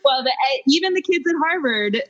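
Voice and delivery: ordinary delivery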